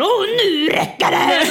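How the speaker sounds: high, loud, slow imitation of snapping; hammed-up now-that's-enough; mock angry outburst. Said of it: "din reaktion på när du blir rädd". (retold arg utbrott, högt och långsamt)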